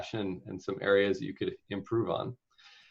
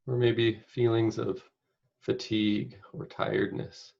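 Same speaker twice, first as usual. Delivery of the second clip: unhurried meditation guide, long pauses between phrases; guided meditation. Acted. Meditation leader inviting listeners to picture caregiving, spacious guided-meditation delivery. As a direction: guide them gently, phrase by phrase, leaving space.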